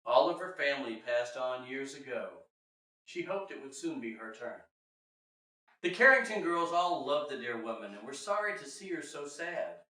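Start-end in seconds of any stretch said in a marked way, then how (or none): none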